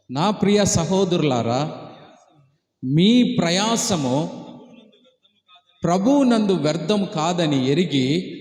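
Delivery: slow, deliberate Bible reading; scripture reading. Acted. Pastor reads the verse slowly to the congregation, solemn and measured. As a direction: read each phrase slowly to the congregation.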